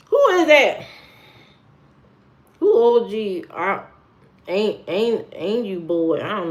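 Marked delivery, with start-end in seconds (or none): 0.1-3.5 high, loud, baffled question; loud bewilderment, pitch shooting up; baffled outburst. Real, not acted. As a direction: demand to know, totally stumped. Baffled by unknown name, asks harder.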